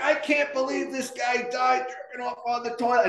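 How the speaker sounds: high-pitched, hugely amused exclamation; gleeful mockery. Sergeant joking with the sister next door, gleeful. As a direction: imitate someone tickled by a grim scene. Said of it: "thinks this is the funniest thing in the world".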